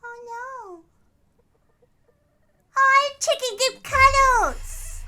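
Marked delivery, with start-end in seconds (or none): 2.8-5.0 put-on slow, loud baby voice for the bird; cutesy bird voice. Voicing the pet bird slowly to a friend, loud and cutesy. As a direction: coo it slowly like a cuddly chicken.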